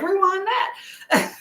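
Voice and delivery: slow, high, laughing "rewind that"; husband caught mid-scene, drawn out and amused; mock husband. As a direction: stretch it out high while laughing. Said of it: "he's like".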